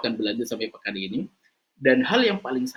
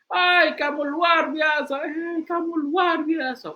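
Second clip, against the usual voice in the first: shouted praise; 3 dB louder, much slower than usual. Acted. Father shouts praise, echo.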